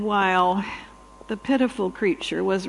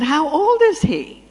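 high, loud surprised ask; a touch of shock in the voice; surprised question; 7 semitones higher, 6 dB louder than usual. Retold or imitated. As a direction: ask it in disbelief at the old dog. Asking the owner the dog's age.

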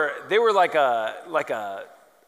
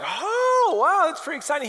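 much higher, mock-thrilled gasp; mock excited; 10 semitones higher than usual. Watching the guitarist about to smash his guitar, mock excited. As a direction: play the eager onlooker, pitch jumping up. Band members reacting to smash.

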